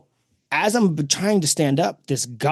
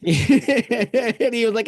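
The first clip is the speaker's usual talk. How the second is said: laughing; 9 semitones higher, much slower than usual. Real, not acted. Laughs while retelling the story.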